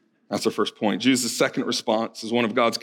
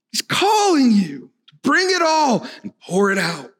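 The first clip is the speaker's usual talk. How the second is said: impassioned preaching; 6 semitones higher than usual. Acted. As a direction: build to a climax urging them to come. Climactic preaching.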